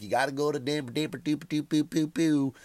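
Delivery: lightly mocking chanted recommendation; car rental clerk. Rental clerk pushing a barbecue place with a hint of chant. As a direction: repeat the name like a catchy jingle. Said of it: "they're like".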